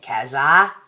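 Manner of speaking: loud Aussie nickname with a drawn-out final vowel; broad Aussie accent. Shown as a demonstration. Vlogger showing a friend how to say her nickname, broad Aussie accent. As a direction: punch out "Kazza" in an Aussie accent. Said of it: "say it like that with an Aussie accent".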